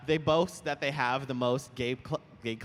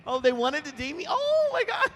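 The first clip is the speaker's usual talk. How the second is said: high, louder delighted squeal; surprised squeal; 13 semitones higher, 5 dB louder than usual. Real, not acted. Guest hearing a fan wanted a date, squealing. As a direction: shriek it in happy disbelief.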